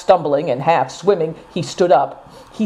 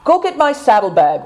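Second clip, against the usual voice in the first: commanding order; 7 semitones higher than usual. A narrator's reading. Naidong orders someone to fetch his saddlebag, brisk command. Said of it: "ordered Naidong".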